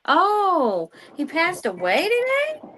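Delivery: sudden high shocked question. Real, not acted. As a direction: caught off guard by the news. Shocked by death news.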